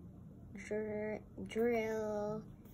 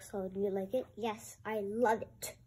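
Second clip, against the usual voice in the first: ordinary delivery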